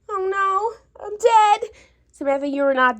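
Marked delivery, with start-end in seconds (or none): 0.1-1.7 panicked crying wail, rising and louder; crying freak-out. Real, not acted. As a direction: wail it out like your life just ended. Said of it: "What are you dead about?".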